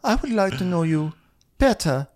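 high, halting put-on voice; Diana. Diana telling Garcelle what she'd like, high mock voice. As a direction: pause high and precious, as a mock impression. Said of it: "Diana's like".

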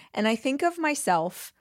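usual speaking voice

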